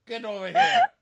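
very high, loud call across the room; hammed-up call to the hay man; mock summons. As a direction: yell for him to come over in a hammed-up voice. Calling the hay man over.

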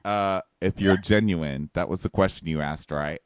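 low put-on voice; low, unruffled impression; mock deadpan guy. Voicing Nick's deadpan reply.

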